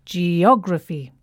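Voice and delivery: geography slow, stress on OG: ge-OG-ra-phy; correct word stress. Shown as a demonstration. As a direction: model the right stress on geography.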